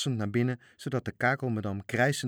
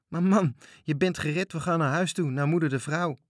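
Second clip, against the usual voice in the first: eager coaxing; 8 semitones higher, 6 dB louder than usual. Acted. Sailors urge Frits home.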